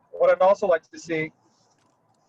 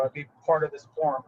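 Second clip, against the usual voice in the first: ordinary talk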